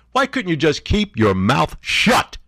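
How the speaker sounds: high, slow, drawn-out rebuke; exasperated. Real, not acted. Host rebuking the absent president, exasperated. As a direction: scold with each word stretched out.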